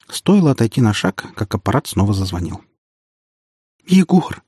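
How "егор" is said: high-pitched tearful cry for help; sobbing. A narrator's reading. Panicked woman phoning her friend, sobbing. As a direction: call out through sobs. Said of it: "С трудом я разобрал сквозь рыдание".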